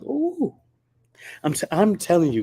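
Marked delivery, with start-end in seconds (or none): 0.0-0.5 high-pitched drawn-out ooh; imitated 'ooh'. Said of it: "he was just like".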